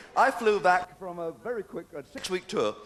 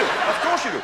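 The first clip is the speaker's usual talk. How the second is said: ordinary delivery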